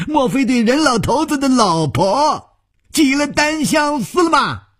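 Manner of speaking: 尖声嘲笑的恶人; mocking villain. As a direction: jeer at him with a shrill taunt. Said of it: "白玉魔笑着说".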